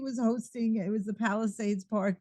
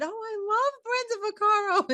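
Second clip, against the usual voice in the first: excited shout; 12 semitones higher than usual. Retold or imitated. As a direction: shout it out like a thrilled fan. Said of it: "And I shouted".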